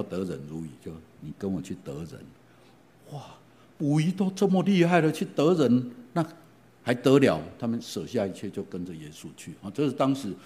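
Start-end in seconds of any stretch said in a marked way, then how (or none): 3.1-7.4 amazed exclamation. Acted. Preacher exclaims over disciples' skill.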